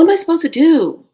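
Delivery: loud, high, desperate demand; anguished outcry. Retold or imitated. Mourner bargaining over his death, anguished outcry. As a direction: cry out helplessly, pitch and volume up.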